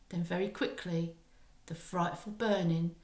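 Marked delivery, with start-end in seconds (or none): none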